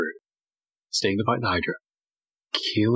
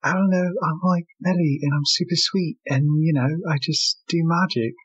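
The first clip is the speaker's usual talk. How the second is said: put-on sweet, higher and louder girlish voice; Melly's voice; 9 semitones higher, 3 dB louder than usual. Friends beg him to do Melly, a sweet girlish voice. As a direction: play the sweet little magic girl. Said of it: "please do Melly's voice".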